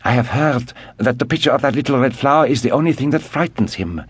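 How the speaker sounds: lightly voiced girl, lifted and eager; a hint of a girl sharing gossip; young Suzanne. Suzanne telling the men what she heard, lightly girlish.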